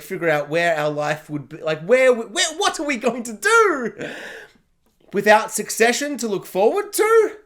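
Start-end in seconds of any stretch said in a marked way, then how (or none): 1.8-7.4 higher-pitched, dramatic wail; hammed-up lost and desperate plea; mock despair. Acts out despair after Succession ended.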